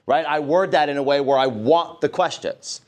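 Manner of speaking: normal talking voice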